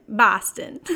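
mock Boston accent on the city name; playful put-on Boston accent. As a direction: say Boston like a local, just for fun. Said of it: "That's where my Boston accent begins and ends".

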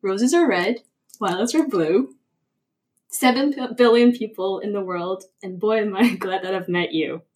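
reciting a rhyming poem; poem recital. Acted. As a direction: read it like a heartfelt poem.

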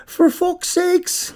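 high, slow drawn-out Irish curse; mock Irish. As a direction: stretch it like a stage Irishman. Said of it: "We'll just have to say a couple".

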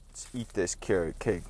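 ordinary talk

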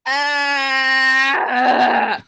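loud, high shriek of panic; anxious scream. Acted. Show title shouted as a scream.

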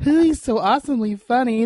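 swooning fangirl. Retold or imitated. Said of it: "as you twirl your hair".

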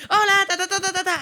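high, loud mock-gushing greeting; gushy email voice. Imitating her own overexcited emails, high and loud. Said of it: "así yo escribo a veces".

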